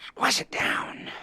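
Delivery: hushed and slow, drawn out; slow creepy whisper. Acted. Lester whispers slowly, urging the player to repress it.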